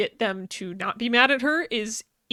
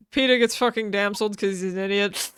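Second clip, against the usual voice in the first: ordinary delivery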